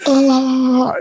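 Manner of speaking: high mock yelps of pain; pained cry. Tech with glue on his thumb, high mock pained yelps. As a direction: imitate a burned hand yelping.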